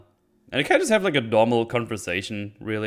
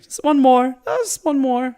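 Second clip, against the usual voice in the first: mock pleading, much higher and louder, fading down by the end; Ric Flair begging; 6 dB louder than usual. Aging wrestler begging for one more match, mock plea fading. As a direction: beg for one last comeback, then trail off. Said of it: "Ric Flair retired, like, seventeen thousand times".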